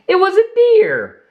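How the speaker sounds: high delighted gasp of discovery; surprised reveal. A narrator's reading. Narrator revealing the deer to young listeners, surprised. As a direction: lift it up in wonder.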